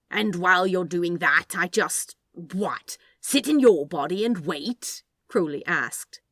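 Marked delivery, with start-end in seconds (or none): 0.1-5.0 louder, indignant questioning; a little heated. A narrator's reading. Crowley objects to sitting in the angel's body, heated. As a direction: push back with rising irritation. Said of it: "a little heatedly".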